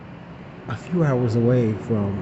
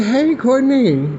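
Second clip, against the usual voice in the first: calling out; 11 semitones higher, 4 dB louder, slower than usual. Retold or imitated. Calling to the back seat.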